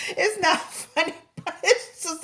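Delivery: can't hold back a laugh while saying it; half-laughing aside to viewers. Real, not acted. Laughing at Iggy's rant.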